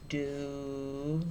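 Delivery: ordinary talk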